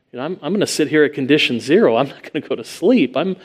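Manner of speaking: fast, tense inner monologue of a warrior; quick mock battle-ready resolve; hurried soldier thoughts. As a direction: rattle it off like a man on watch. Imagined soldier's inner talk.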